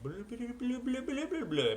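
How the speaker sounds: high, rolling nonsense babble; babbled gibberish to go with hand motions; high-pitched babble. Acted. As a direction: burble blah-blah nonsense way above your voice. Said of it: "I'm doing hand motions".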